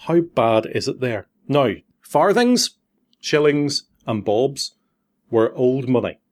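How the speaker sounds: ordinary talk, nothing marked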